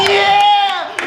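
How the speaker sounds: loud high cheer; a high shouted yeah. Real, not acted. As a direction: yell it out triumphantly.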